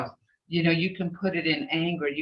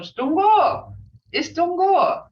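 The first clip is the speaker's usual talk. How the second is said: bright cheerful greeting, much higher and louder; high, eager hello; excited greeting tone; 12 semitones higher, 5 dB louder, slower than usual. Shown as a demonstration. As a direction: call out the greeting happily. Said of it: "Hear that tone?".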